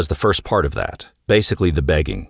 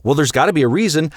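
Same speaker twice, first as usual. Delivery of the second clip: pressing husband; 8 semitones higher, much faster than usual. Retold or imitated. Acted quarrel, husband pressing.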